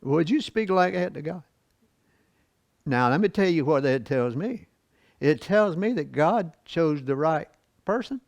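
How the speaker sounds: ordinary talk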